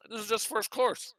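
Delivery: talking around a mouthful; eating while talking; mouth full. Real, not acted. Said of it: "I shouldn't be talking with my mouth full".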